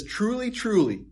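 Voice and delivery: "Truly, truly" said high and weighty; stress on truly. Shown as a demonstration. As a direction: lean hard on "Truly, truly". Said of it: "huge emphasis here".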